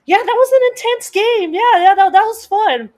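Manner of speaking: high, loud, over-bright imitation of a fiancée; fiancée's voice. Fiancée grabs him by the waist after the game, placating. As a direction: pipe up high to smooth it over. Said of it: "and she's like".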